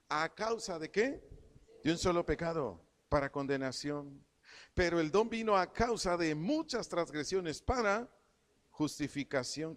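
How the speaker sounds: preacher proclaims the verse, leaning on each phrase; scripture reading. Acted. Preacher reads Romans to the church, emphatic scripture reading.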